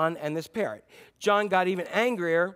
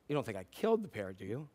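worried mutter; 6 semitones lower, 8 dB quieter, faster than usual. Retold or imitated. John worried after freezer slam.

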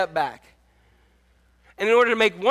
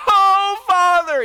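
higher, louder mock wail; theatrical cry of Father; hammed-up plea; 11 semitones higher, 6 dB louder, much slower than usual.

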